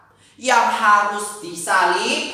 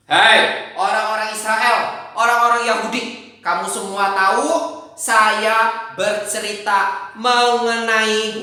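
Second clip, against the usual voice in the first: louder, calling out to a crowd; preaching call; 3 semitones higher, 6 dB louder than usual. Acted. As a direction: proclaim like a preacher, slowing at the end. Peter addresses the crowd loudly.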